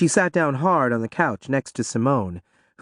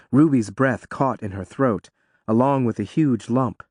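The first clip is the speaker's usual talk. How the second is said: ordinary delivery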